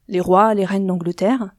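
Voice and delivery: ordinary delivery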